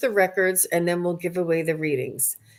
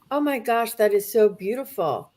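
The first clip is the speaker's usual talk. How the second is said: ordinary delivery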